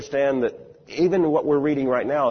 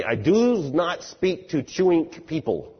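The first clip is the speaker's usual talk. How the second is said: slower, higher-pitched mock German accent; imitated German accent; 6 semitones higher, slower than usual. German scholar refusing to address people who eat, imitated German accent. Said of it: "with this very deep German accent".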